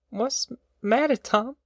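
put-on a kid asking Tom quietly; hushed child voice. A narrator's reading.

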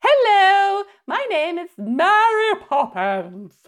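high, slow, prim and proper English nanny; hammed-up posh nanny greeting; mock Mary Poppins. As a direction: trill it like an overly posh storybook nanny. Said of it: "she literally is".

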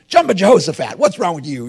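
hammed-up frustrated exclamation; mock exasperated outburst. Imagined exclamation under trial.

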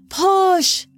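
high, urgent shout; shouted command. Acted. Midwife to a woman in labour, urgent high shout.

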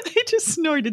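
snorting laugh into words; amused snort. Real, not acted. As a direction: snort then giggle. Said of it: "Did you just snort?".

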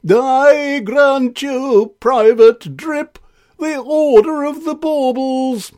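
slow, grand, pompous king. Acted. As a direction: bestow the honour with great pomp. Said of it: "in a very pompous voice".

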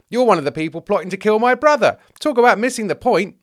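high, loud outburst; indignant accusation. Acted. James turns on the teacher of the law, indignant.

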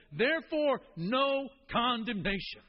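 ringing emphasis on "no condemnation"; emphatic. Acted. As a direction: proclaim it as the key point. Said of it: "is going to be emphatic".

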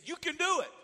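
high, loud call from the water; dad shouting up from the pool, high and loud; dad calling encouragement. Retold or imitated. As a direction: urge the boy to jump. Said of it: "he said".

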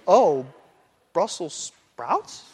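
slow, wondering rise; studying a sprout, puzzled; puzzled scientific curiosity. Shown as a demonstration. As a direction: peer at it, curious. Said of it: "You could be staring at a Brussels".

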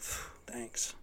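soft whispered phew of relief; relieved whisper. Retold or imitated. Relieved after the recruiter reassures him, whispered. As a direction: sigh the thanks out in a whisper.

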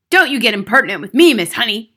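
indignant headmistress; snapped. A narrator's reading. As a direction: snap at an insolent teacher. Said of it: "the Trunchbull snapped".

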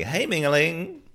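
high, lilting echo of the catchphrase; sing-song imitation. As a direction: mimic "Hey, Mingling" high and sing-song. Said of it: "It's very sing-song, isn't it?".

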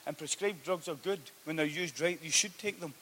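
loud, driving sermon to the congregation; preaching at full force. Acted. Preacher urging the church to take Jesus at his word, loud and driving. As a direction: press every line home like a revival preacher.

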